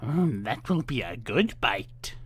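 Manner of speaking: slow, lightly voiced greedy witch; lightly voiced witch relishing a meal; witch mumbling. A narrator's reading. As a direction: relish it under your breath. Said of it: "she mumbled to herself".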